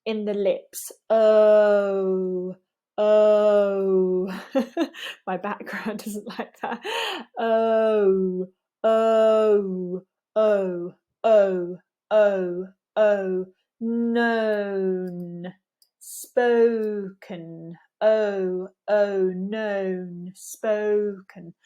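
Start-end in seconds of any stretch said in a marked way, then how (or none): 1.1-4.4 long "oh" gliding into rounded lips; exaggerated glide on "oh"; moving O diphthong. Shown as a demonstration. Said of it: "We want to see movement". 7.4-21.5 "oh" drawn out, gliding to a round shape; slow demonstration of the moving "oh"; moving O diphthong. Shown as a demonstration. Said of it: "We want to see movement".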